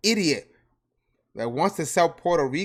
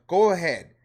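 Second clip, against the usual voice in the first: normal talking voice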